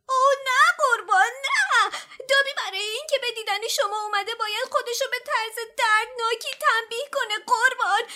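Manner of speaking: high, loud, panicked elf voice; a terrified elf pouring out the punishment; fast, shrill, near-crying fright; trembling with fear. A narrator's reading. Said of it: "دابی به خود لرزید و گفت".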